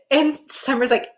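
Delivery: ordinary talk, nothing marked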